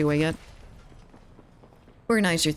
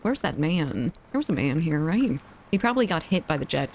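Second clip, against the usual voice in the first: ordinary talk, nothing marked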